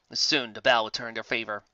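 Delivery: ordinary delivery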